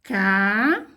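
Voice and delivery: drawn-out syllable "Casa" with a rise; sounding out a word for children; slow syllable spelling. Shown as a demonstration. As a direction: stretch "Casa" out syllable by syllable as she writes. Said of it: "a Pro vai escrever aqui a resposta".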